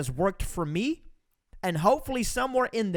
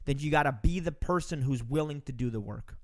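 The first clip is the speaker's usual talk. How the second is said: normal talking voice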